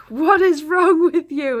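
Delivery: high, loud, slow mock shout; retold shout. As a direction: yell it into someone's face, retelling. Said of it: "just shouted full on in my face".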